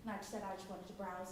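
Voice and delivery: ordinary delivery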